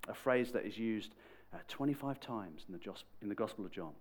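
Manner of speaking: ordinary talk, nothing marked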